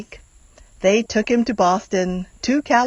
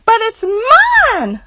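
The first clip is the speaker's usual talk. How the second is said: much higher, loud, slow scream; 12 semitones higher, 6 dB louder, slower than usual. Acted. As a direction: shriek to claim the egg back. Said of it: "screamed the bird".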